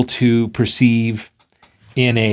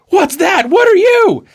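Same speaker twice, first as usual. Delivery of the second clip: high, shouted astonishment; startled alarm; 4 dB louder than usual. Acted. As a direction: yelp it at a baffling apparition. Johnny startled by a strange speck.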